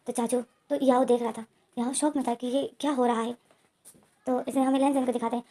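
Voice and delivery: usual speaking voice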